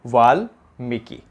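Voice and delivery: वाल्मीकि said slowly with a long mee; slow careful pronunciation. Shown as a demonstration. Teacher to students, slowly modelling the correct word. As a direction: stretch वाल्मीकि, long ee in the middle. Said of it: "शुद्ध क्या हो जाएगा".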